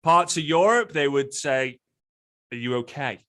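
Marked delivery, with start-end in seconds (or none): 2.5-3.2 put-on low, softer European-accented question. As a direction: lightly imitate a European accent. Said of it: "in their accent".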